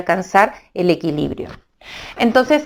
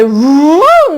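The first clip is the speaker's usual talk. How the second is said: whoosh sound; 8 semitones higher, 7 dB louder than usual. Acted. Ship whooshes home.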